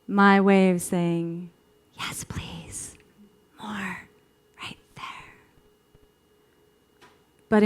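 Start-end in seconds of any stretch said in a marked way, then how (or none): 1.9-5.3 hushed, breathy bedroom whisper; intimate whisper. Acted. Woman guiding her lover, intimate whisper.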